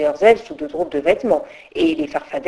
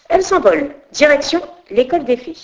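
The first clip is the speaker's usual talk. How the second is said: usual speaking voice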